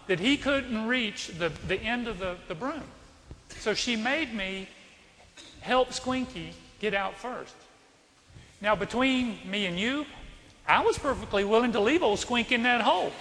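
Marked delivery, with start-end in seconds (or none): none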